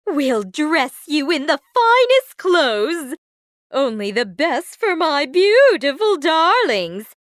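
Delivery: loud, high gushing stepmother; exultant, theatrical proclamation; excited cry. A narrator's reading. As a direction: announce thrilling news with grand relish. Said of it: "she cried".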